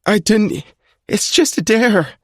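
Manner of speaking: high frightened plea; crying out. A narrator's reading. Duncan pleading with his attacker, high frightened cry. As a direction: cry it out, scared stiff. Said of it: "he cries out".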